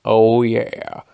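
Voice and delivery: loud, drawn-out agreement at normal pitch. Retold or imitated. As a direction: imitate a second coworker chiming in. Second coworker agreeing, contrasting voice.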